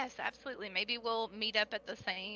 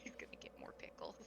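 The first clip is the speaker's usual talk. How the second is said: whispering; 16 dB quieter, faster than usual. Real, not acted. Giggling aside to the table.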